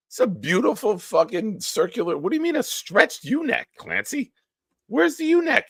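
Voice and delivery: high-pitched, exasperated disbelief; incredulous. Real, not acted. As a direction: protest in a squeaky, baffled voice. Baffled by being told of a U-neck.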